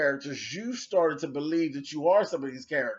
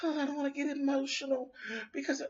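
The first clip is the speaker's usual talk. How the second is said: higher, softer, quick simpering whine; mocking whiny quote; 8 semitones higher, 9 dB quieter, faster than usual. Mocking the rival's video plea in a soft high whine. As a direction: mimic his fake-tearful plea. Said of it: "all sad and".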